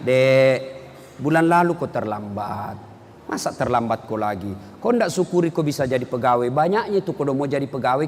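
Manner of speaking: gentle reprimand. Retold or imitated. Boss gently reprimands late employee.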